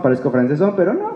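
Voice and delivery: ordinary talk, nothing marked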